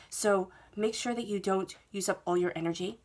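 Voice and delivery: ordinary talk, nothing marked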